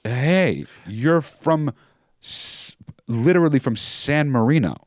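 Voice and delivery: exasperated retelling with emphatic pauses; retold call-out. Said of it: "And I was like".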